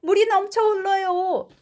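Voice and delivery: high-pitched cry of alarm; acted shout. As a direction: call out a warning as story dad. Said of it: "블레어 씨가 소리쳤습니다".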